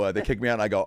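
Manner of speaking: usual speaking voice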